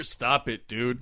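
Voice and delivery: ordinary talk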